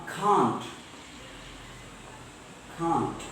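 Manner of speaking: CAN'T with aspirated k, "khaant"; British can't. Shown as a demonstration. Teacher correcting the k sound, CAN'T as "khaant". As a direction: puff of air on the k, open "ah" in CAN'T. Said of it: "Not actually ca".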